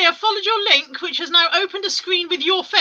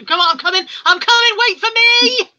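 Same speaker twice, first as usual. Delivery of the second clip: higher, louder call to wait; 8 semitones higher, 5 dB louder than usual. Real, not acted. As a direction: call after it as if left behind. Calling after a slow screen.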